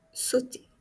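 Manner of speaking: sauter. whispered, unvoiced; sauter. as a hushed echo; whispered repeat. Shown as a demonstration. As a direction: breathe sauter. softly. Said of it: "repeated softly".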